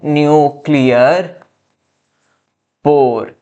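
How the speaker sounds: slow, drawn-out term, word by word; slow dictation. Shown as a demonstration. Teacher repeats a new term for students, slow dictation. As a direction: stretch each word out slowly, pausing between them.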